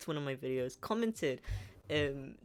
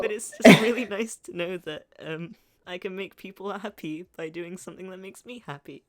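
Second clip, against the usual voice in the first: higher, tearful voice with a laugh breaking in; happy tears; 5 semitones higher, 12 dB louder than usual. Real, not acted. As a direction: push on through real tears. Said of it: "I'm crying".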